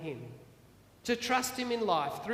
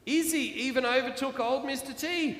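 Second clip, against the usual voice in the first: plain reading voice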